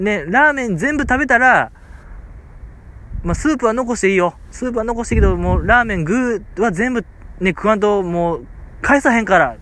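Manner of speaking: a touch of a bossy shopkeeper laying down rules; mild mock gruff shopkeeper. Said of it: "結構怒鳴り気味やったからね". (客に厳しく言い渡す店主を軽く真似て)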